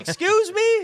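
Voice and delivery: high indignant excuse me; stunned disbelief. Retold or imitated. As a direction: yelp it like you can't believe the bill. Customer reacting to huge bill.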